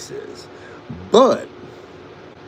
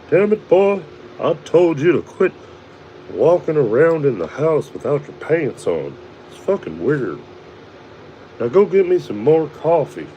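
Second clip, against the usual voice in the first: a raised, higher cartoon-dad rant at his son; gruff cartoon dad; 5 semitones higher than usual. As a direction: bark at the boy like a cranky cartoon father. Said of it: "I sound like Bob's Burgers or Archer".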